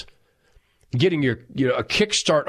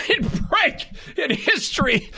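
higher-pitched, laughing through the words; breaking into laughter; 13 semitones higher than usual. Real, not acted. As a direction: read on while cracking up. Reads absurd claim while laughing.

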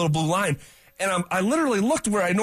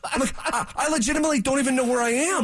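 bewildered; 7 semitones higher than usual. Retold or imitated. Retelling waking up lost on the road.

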